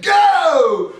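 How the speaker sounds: loud, high shout of Go; commanding shout. Acted. Moses orders the crossing.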